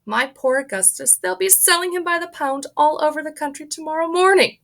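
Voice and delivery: high, loud wailing mother; distraught mother. Acted. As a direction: wail for her lost boy. Mrs Gloop wails over son.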